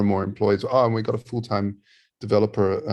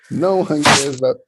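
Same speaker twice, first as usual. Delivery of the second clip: loud, high echo of a rant, through a laugh; teasing, raised-voice imitation; mock rant; 6 semitones higher, 8 dB louder than usual. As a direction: laughingly mimic your co-host, pitched up and loud. Laughingly echoes co-host's rant.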